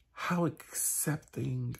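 normal talking voice

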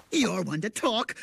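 ordinary talk

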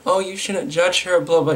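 high, whiny mock voice of her defenders; mocking critics. Vlogger mocking people who defend the drunk driver, high whiny parody. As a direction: sneer it out like a sarcastic parody. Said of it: "And like".

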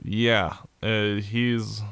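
normal talking voice

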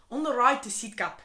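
reading a post in South African; South African-accented read-out. Acted. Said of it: "I love South African".

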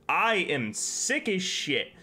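ordinary talk

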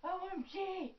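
high gushing fangirl squeal; overacted fangirl. Acted fangirl skit.

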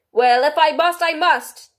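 high, loud kingly voice with mild gloom; resigned royal sigh of a line, pitched high; melancholy King. A narrator's reading. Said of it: "said with a melancholy air".

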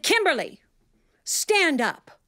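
teacher calling out a pupil mid-class; stern teacher call. Retold or imitated. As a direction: call her name out firmly. Teacher calls child out sternly.

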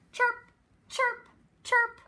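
high-pitched chirps like a bird; bird chirping. Acted. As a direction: chirp it high for a small child to copy. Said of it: "A bird says".

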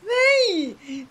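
normal talking voice

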